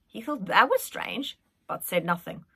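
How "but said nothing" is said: usual speaking voice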